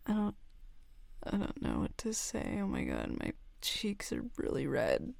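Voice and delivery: ordinary talk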